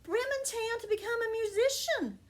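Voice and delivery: the donkey cheerfully invites the dog along; eager donkey. Acted. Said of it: "And the donkey said".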